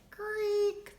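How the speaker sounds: a high, loud creak of the refrigerator door; creaky door sound. A narrator's reading. Narrator voicing the fridge door with a high creak. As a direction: squeak it out like an old hinge.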